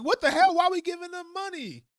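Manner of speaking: a hammed-up outraged citizen; mock outraged citizen. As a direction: blow up like a fed-up taxpayer. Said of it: "we'll be like".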